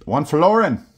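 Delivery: put-on cheesy Australian accent, "florin" drawn out; fake Australian accent. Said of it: "my fake Australian accent".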